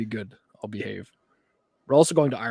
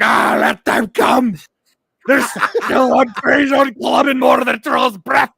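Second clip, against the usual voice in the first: full-throated defiant shout; high, loud, hammed-up warrior bellow; battle cry; 11 semitones higher, 10 dB louder, slower than usual. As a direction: roar at the coming enemy. Acts out defiant Scottish battle cry.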